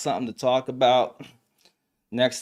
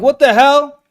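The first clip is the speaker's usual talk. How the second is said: high, loud startled yell; retold yell; 14 semitones higher, 13 dB louder than usual. Woken in a car by an angry ex, a loud startled yell. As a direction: bark it at the banging window. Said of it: "I'm like".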